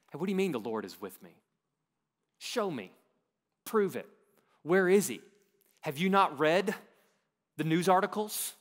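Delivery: demanding Gideon. Acted. Preacher voices Gideon's demanding doubt.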